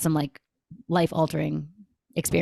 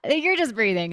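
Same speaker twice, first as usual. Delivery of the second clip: higher, incredulous scoff; mock skeptical; 7 semitones higher than usual. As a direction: scoff at it like it's a joke. Skeptic mocks breathwork.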